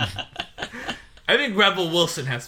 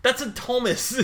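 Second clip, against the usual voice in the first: quirky character quote; 9 semitones higher than usual. Retold or imitated. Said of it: "He's like".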